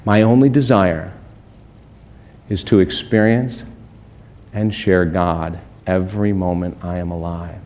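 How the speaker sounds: soft, low, slowed inspirational musing; hushed reflection. Acted. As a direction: draw them inward, gently and quietly. Quiet reflective prayerful passage.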